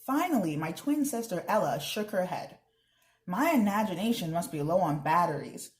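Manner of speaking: lively read-aloud with character voices; teacher reading a chapter book, voices the characters; reading aloud to children. Acted. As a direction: read the story to the class with expression. Reads chapter aloud to class.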